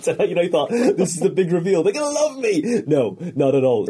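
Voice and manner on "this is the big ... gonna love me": high, loud hopeful squeal, half laughing; mock excitement. Comic expecting the big reveal, mock excited high voice. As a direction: crow it high, mocking your own hopes. Acts out naive hope before flop.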